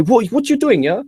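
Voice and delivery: high, loud mock outburst; hammed-up boss reaction; mock boss yell. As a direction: bark it like a startled boss. Boss yelling in retold scene.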